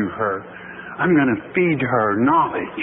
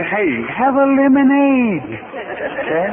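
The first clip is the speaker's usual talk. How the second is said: playful cheery voice; 8 semitones higher, slower than usual. Acted. Cheery advice after a lemon.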